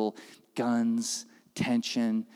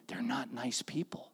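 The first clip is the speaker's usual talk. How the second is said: a hushed, secret whisper; 9 dB quieter than usual. Retold or imitated. Driver confides about the hotel staff, whispered. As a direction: whisper it so the hotel won't hear. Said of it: "He goes".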